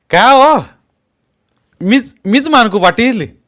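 high, loud, hot-tempered answer; match his anger word for word; heated reply. A narrator's reading. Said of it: "तेवढ्याच तावात बोलला". (तावातावाने उत्तर)

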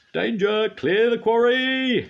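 very high, loud alarm call; shouted warning. A narrator's reading. Quarry master warning the workmen of rockfall, loud high shout. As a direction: yell it across the quarry, slowly. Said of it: "shouted the quarry master".

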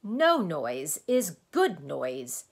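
loud, slow, happy crowing; happy exclamation. A narrator's reading. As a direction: exclaim it gleefully, joining the joke. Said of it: "exclaimed the humbug happily".

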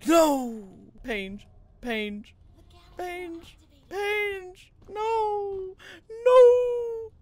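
fake-despairing whine that climbs; mock anguish. Streamer wailing at his failed speedrun skip, mock crying.

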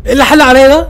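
jolted by a sudden scare, yelp it out; startled outburst. Real, not acted. Startled by something in game.

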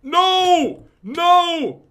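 high, loud cries of "no"; alarmed shout. Real, not acted. Gamer in sudden trouble, alarmed shout.

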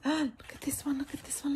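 high breathless whisper; excited whisper. Real, not acted. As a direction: gasp it out, barely voiced. Gasping at bookmarks.

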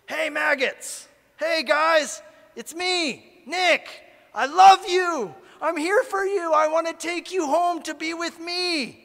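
high, loud, slow shouted pleas with a laugh at the end; yelling at maggots. Pretend. Speaker calling out to maggots on the trail, high loud joyful yell. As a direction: bellow it out with overflowing love. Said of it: "And so you start yelling at 'em".

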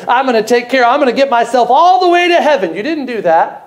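high, ringing pulpit voice carried over the congregation; preaching at full force. Acted. Preacher telling the congregation about Jochebed, at full force.

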